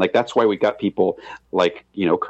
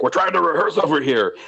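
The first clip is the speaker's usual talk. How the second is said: mock angry Tierney; 7 semitones higher than usual. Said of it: "he got really mad at some dude".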